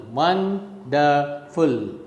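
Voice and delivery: "wonderful" slowly in three parts, won-der-ful; "wonderful" spaced out as won, der, ful; split into syllables. Shown as a demonstration. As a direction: break "wonderful" syllable by syllable, slow and softening. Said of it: "Some words are trisyllabic words".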